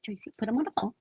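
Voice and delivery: toned-down insistence; quiet demand. Acted. Same demand, now toned down.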